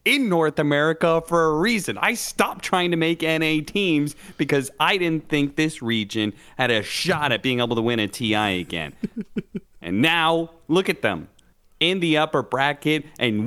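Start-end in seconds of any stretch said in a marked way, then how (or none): 0.0-8.9 mock Snaking rant. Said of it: "Snaking's like".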